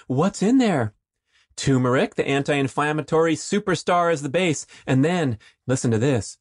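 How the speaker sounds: high, bright commercial pitch; upbeat ad read. Acted. Host reading a sponsor ad, upbeat announcer voice. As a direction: sell it hard like a radio spot.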